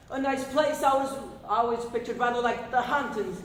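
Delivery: ordinary talk, nothing marked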